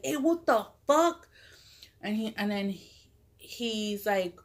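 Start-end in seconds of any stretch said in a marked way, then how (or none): 0.0-1.3 higher, slower startled shout; startled uncle. Retold or imitated. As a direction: retell the uncle's alarmed yell, pitched up. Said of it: "and he's like".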